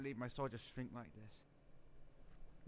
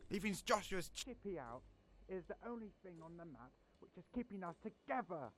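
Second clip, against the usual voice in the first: ordinary talk, nothing marked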